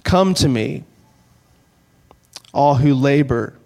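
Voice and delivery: slow and unhurried, with long pauses between phrases; read slowly. Shown as a demonstration. Pastor rereads Jesus' words slowly as the congregation closes their eyes. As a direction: let each phrase settle before the next. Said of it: "I'm gonna read it slowly".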